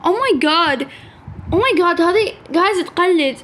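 ordinary delivery

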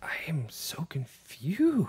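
soft breathy whisper. Real, not acted. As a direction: whisper it to yourself. Whispered aside during movie.

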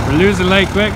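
ordinary talk, nothing marked

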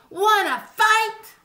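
high, slow squeaky dare; grouchy ladybug. A narrator's reading. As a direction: challenge them in a tiny high voice. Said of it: "said the grouchy ladybug".

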